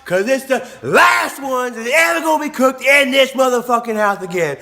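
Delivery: high, loud imitation of a rant; hammed-up retold ultimatum; serious angry dad. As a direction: lay down the law as furious dad. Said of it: "he was serious".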